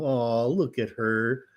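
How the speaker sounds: viewers cooing. Retold or imitated. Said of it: "and go".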